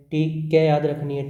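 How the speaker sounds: ordinary talk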